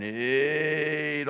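long-held, stretched number; slow-motion count. Retold or imitated. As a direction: stretch it out as a slowed-down referee. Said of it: "slows down to slow motion".

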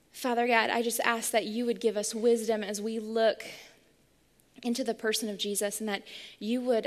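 soft, low, earnest prayer voice. Real, not acted. Pastor opening the sermon praying to God, softly and earnestly.

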